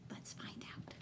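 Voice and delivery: put-on secret whisper to the kids; hushed suspense. Shown as a demonstration. Teacher teasing children's suspense.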